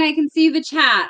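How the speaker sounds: normal talking voice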